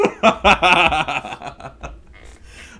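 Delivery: big high laugh; loud laugh. Real, not acted. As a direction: burst out laughing. Laughs after mocking opponent.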